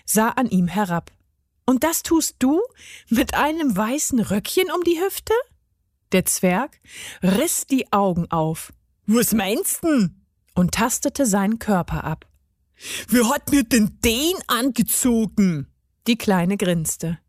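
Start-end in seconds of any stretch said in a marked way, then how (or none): 1.7-5.3 put-on high-pitched child's voice; pert, doubting child; sceptical little girl. A narrator's reading. Girl mocks the gnome's skirt. 9.1-10.3 slow dialect dwarf voice; put-on grumpy puzzlement; puzzled garden gnome. A narrator's reading. 13.1-15.7 affronted dwarf in dialect; put-on cranky astonishment; indignant garden gnome. A narrator's reading. Gnome discovers his skirt.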